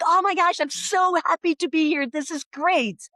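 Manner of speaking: imitating an overexcited American greeting; over-enthusiastic American. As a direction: gush as if thrilled to be here. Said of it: "because they're so enthusiastic".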